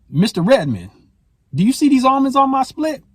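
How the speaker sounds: a hint of a movie gangster, high-pitched questioning; Bumpy Johnson. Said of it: "he plays a gangster named Bumpy Johnson".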